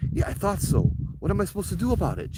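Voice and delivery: rushed retold panic; anxious question. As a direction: driver asking the psychic for help. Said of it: "I'm like".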